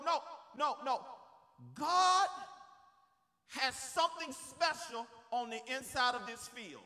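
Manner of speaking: normal talking voice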